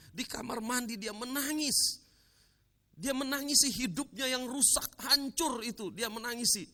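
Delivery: high, loud impassioned preaching; fervent preacher, rising high and loud; preaching at full force. Acted. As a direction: pour the drama of his tears into the crowd. Preacher builds to emotional climax.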